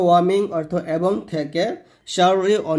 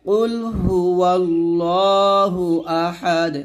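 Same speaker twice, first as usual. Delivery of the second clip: slow chanted কুল হু ওয়াল্লাহু Ahad with bounced দ; slow Quran teacher demonstration; model recitation; much slower than usual. Shown as a demonstration. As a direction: recite slowly, bounce the final দ. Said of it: "আবার বলেন".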